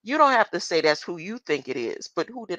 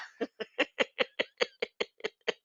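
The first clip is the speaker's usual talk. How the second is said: breathy laugh; 5 semitones higher, 5 dB quieter than usual. Real, not acted. Laugh after burst.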